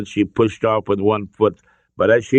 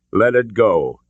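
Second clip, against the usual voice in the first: ordinary delivery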